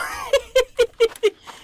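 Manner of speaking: shrill startled laugh; high nervous laugh. Real, not acted. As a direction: laugh out in high-pitched shock. Monster rushes player.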